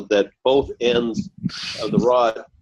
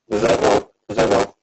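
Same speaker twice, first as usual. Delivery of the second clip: warped, rubbery mic distortion; rubber band mic sound. Real, not acted. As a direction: talk through a faulty, twangy mic. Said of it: "you sound like a rubber band".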